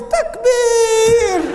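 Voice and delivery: very high, loud cry; crowd shout. Retold or imitated. A man in the audience shouting high and loud. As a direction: yell it from the back of the hall. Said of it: "one guy in the back goes".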